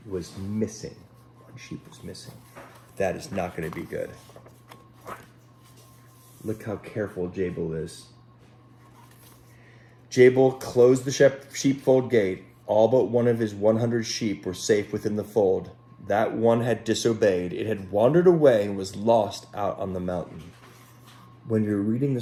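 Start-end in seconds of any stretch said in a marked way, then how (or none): 1.4-2.3 breathy whispered echo of the line; hushed dramatic whisper. Acted. 10.1-20.4 gentle storybook reading voice; unhurried picture-book narration; storytelling. Acted. Children's story read aloud.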